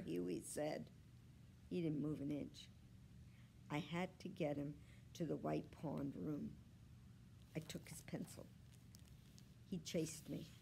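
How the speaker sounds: ordinary delivery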